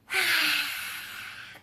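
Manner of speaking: long loud breath out like a dragon. Shown as a demonstration. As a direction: blow out fire. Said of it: "Take a deep breath".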